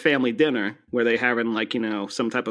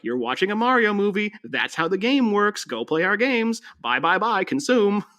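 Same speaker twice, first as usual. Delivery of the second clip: higher, sarcastic sales voice; higher mocking salesman voice; mock corporate pitch; 7 semitones higher than usual. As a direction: sell it like a pushy studio ad. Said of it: "another reminder of, like".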